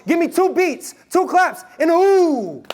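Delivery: chanted high crowd call; hype call. Shown as a demonstration. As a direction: call it out to pump the room. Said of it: "So I say".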